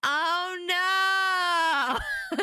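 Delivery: drawn-out, high cry of dismay; high, stretched-out outburst; amused dismay. Real, not acted. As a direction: wail it in playful horror. Reacting to the failed disguise.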